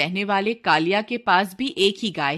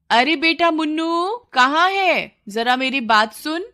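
higher, louder call across the house; 8 semitones higher, 5 dB louder than usual. Acted. Mother calls out to her son Munnu, loud and high. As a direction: call your son from another room.

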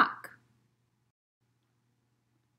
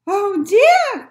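put-on very high, loud old-lady squeal; high-pitched storybook dismay; old lady crying out; 11 dB louder than usual. A narrator's reading. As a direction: exclaim in alarm as a little old lady. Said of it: "cried the old lady".

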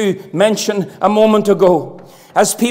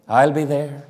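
much lower and softer, a hushed echo of the vow; quiet lower repeat of I'll be there; 7 semitones lower, 6 dB quieter than usual. Shown as a demonstration. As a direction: drop it down low and quiet, like an afterthought. Preacher repeats vow, then echoes softly.

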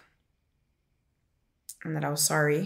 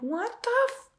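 very high, cut-off squeak of disbelief; retold high-pitched bafflement; baffled disbelief. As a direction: act out bewildered shock, pitch shooting up. Retold shock at his laughing.

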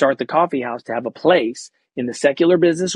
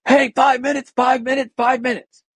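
calling out; 10 semitones higher than usual. Retold or imitated. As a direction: shout the time warning up high. Crew calling him to set.

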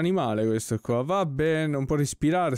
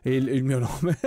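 normal talking voice

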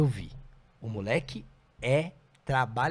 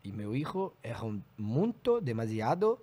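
slow, halting Spanish-accented impression; imitated Spanish accent; 3 semitones lower, slower than usual. Quoting Maurício on disciplining his kids, slow Spanish accent. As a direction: imitate a Spanish speaker picking through Portuguese. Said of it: "ele falou assim".

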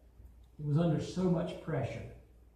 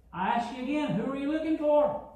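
higher, louder challenge to the soldiers; calm challenging Jesus; 8 semitones higher, 3 dB louder than usual. Acted. Jesus faces the arresting soldiers, louder and high. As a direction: ask it again, knowing the answer. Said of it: "imagine Jesus going over and saying".

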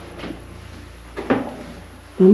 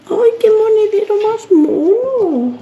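much higher, slow, gushing over something cute; cutesy delight; 12 semitones higher, 4 dB louder, slower than usual. Real, not acted. Vlogger finding a little purse, squealing high and slow. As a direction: coo over it like a tiny treasure.

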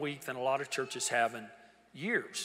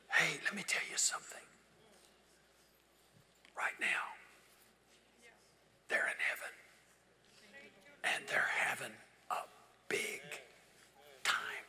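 whisper; 6 dB quieter than usual. Real, not acted. Confiding heaven secret to congregation.

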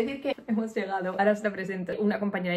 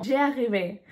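lightly acted Spanish-accented French, pitched up a little; bad French accent; 2 semitones higher, 6 dB louder than usual. Vlogger retelling her attempt at French to a driver, bad French accent. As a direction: try French with a clumsy accent. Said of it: "tan mal acento tengo".

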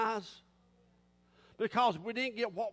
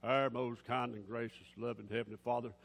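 low, measured, pious prayer; mock formal prayer; 11 semitones lower than usual. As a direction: intone it solemnly, swelling on the last phrase. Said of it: "we don't need to start a prayer".

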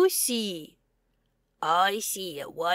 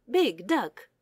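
ordinary delivery, nothing marked

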